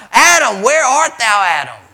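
loud, high call to Adam; God searching for Adam, calling. Acted. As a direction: call across the garden.

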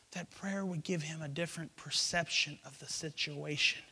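ordinary talk

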